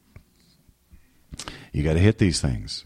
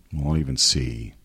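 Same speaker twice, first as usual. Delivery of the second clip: usual speaking voice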